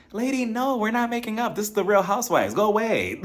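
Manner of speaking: high, mock-exasperated brush-off; shooing away a meddling lady, pitched high; telling the mom to leave her alone, high and exasperated; exasperated retort.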